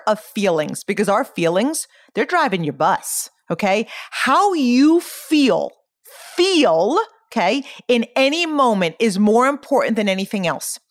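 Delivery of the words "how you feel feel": "feel" stretched long and high; stress on FEEL. Real, not acted. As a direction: lean hard on "feel", drawing it out. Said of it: "I'm emphasizing the word feel".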